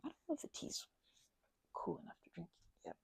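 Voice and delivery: whispered aside to herself, unvoiced. Real, not acted. Talking to herself about the tea, whispering.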